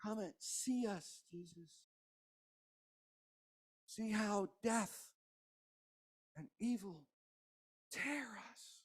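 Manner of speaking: soft, slow, broken plea; hushed plea. Acted. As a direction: whisper it to Jesus at the tomb. Mary pleading at tomb.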